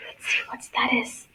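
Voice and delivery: whispered, teasing the surprise; secret whisper. Acted. Teacher whispering to the class to build suspense. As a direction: lean in and whisper the question.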